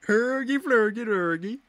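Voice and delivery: high, loud mock Swedish gibberish; Swedish Chef. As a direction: babble like the Muppet chef. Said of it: "Swedish Chef".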